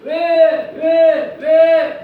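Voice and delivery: building from a slow loud lament to shouted whys; anguished outcry. Retold or imitated. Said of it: "이렇게 소리를 지르죠".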